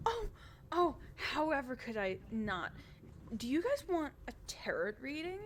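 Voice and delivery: high, bubbly noble lady; cheerful Adela. Acted. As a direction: chirp it up like an eager hostess. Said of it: "Adela turns to you and goes".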